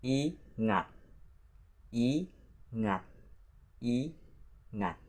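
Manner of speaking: ingat split into i, then ngat; soft ingat with a pause between i and ngat; syllable by syllable. Shown as a demonstration. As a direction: say ingat in two separate pieces, i... ngat. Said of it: "There are two syllables in this word".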